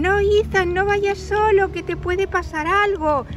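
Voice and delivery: put-on worried children. All the children warning Ethan not to go alone, high and loud. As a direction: cry out together to stop a little friend. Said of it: "Y todos dicen".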